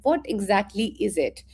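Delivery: normal talking voice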